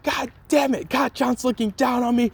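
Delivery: high, strained lament; despairing Deku. Retold or imitated. Deku despairs alone, bloody knuckles, high strained lament. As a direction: plead upward in frustration. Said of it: "He's like".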